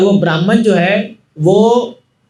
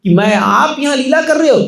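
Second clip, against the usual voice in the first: higher voice, overjoyed devotee; ecstatic joy; 6 semitones higher than usual. Acted. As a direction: burst out in blissful rapture. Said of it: "पूरा ecstasy में आ गया". (ब्राह्मण भगवान को पहचानकर परमानंद में ऊँचे स्वर में)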